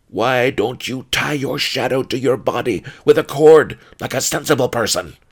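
loud, high-pitched irritable grumble; grumbling. A narrator's reading. Pawnbroker scolding his superstitious colleague, loud grumble. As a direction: grumble it forcefully, exasperated with a fool. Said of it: "Pawnbroker Fang grumbled".

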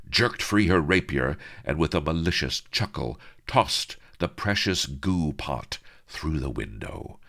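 ordinary delivery, nothing marked

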